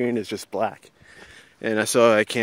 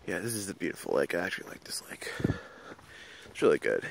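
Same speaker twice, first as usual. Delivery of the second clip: whispering; 4 semitones lower, 9 dB quieter, faster than usual. Real, not acted. Whispering about the lake.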